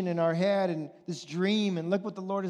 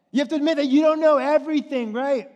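fast, high, loud push on KNOW; preaching shout; 7 semitones higher, 7 dB louder, faster than usual. Real, not acted. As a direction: hammer the point home to the church.

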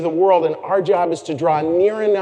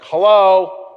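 loud, higher-pitched mock dread hello; 4 semitones higher, 8 dB louder than usual. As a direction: answer like a dreaded telemarketer is calling. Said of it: "I answer with the most dread voice".

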